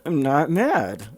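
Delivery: slow, forced "I'm not mad" pushed through clenched teeth. Pretend. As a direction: grit your teeth and insist you're not mad. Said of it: "te-, teeth clenched. Teeth clenched".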